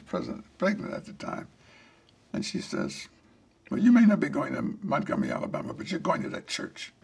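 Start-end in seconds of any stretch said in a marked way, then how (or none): 3.7-6.9 retold combative retort, quick and a bit louder; a touch of mock defiance; belligerent. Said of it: "belligerent mood".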